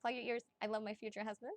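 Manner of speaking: ordinary talk, nothing marked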